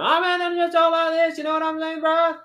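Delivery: put-on loud, very high, garbled Southern mountain accent. Imitating incomprehensible locals for a friend, exaggerated mountain accent. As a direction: run the words together in a hammed-up hillbilly accent. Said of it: "you can't even understand them".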